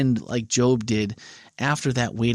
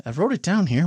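ordinary delivery

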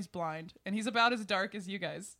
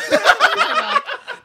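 high, slow hammed-up shout; loud shout; 16 dB louder, slower than usual. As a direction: bellow it across the room. Bar crowd voiced, shouted.

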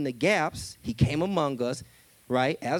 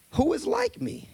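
high challenging God voice; 12 semitones higher than usual. Acted. God's challenge acted in sermon.

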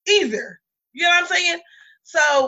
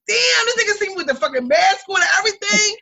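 indignant disbelief; 7 semitones higher than usual. Real, not acted. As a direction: shout it in outraged disbelief. Outraged he recognized her.

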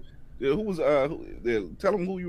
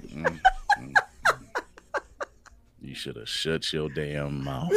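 disapproving; 8 semitones lower, 6 dB quieter, slower than usual. Retold or imitated. Imitating head shake of disapproval.

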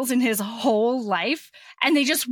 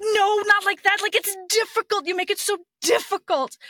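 exasperated pleading; 10 semitones higher, 3 dB louder than usual. Retold or imitated. Frustrated husband pleading mid-sex.

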